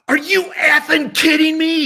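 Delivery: high, full-voiced shout of disbelief; retold yelling. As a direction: imitate his stunned, delighted outburst at the top of your voice. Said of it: "he starts yelling".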